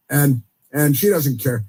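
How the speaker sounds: normal talking voice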